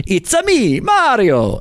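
high, exaggerated Italian Mario voice; Mario impression. Explaining the Italian accent trick, a high Mario voice. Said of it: "you just start with".

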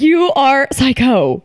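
mock boyfriend voice, high, loud and slow. Friend mimics the boyfriend teasing her, loud and slow. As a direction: ham up the boyfriend calling her psycho. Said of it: "and he was like".

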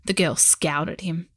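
plain reading voice

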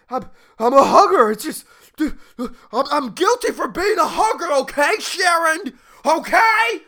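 high, loud defensive whine; panicked CEO. Retold or imitated. Trembling CEO defending himself to his wife, high and loud. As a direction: plead your innocence up high. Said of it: "And he's just like".

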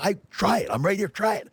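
very high-pitched imitation of a heated coach; retold angry goading; mock yelling. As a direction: dare them, voice pitched right up. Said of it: "And he's yelling at me".

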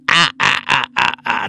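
creepy ghost laughing voice; eerie laugh imitation. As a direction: let the ghoul's laugh leak into the words.

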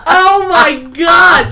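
loud drawn-out gasp of shock; shocked shout. Real, not acted. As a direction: shout it out in disbelief. Shocked reaction to hookup story.

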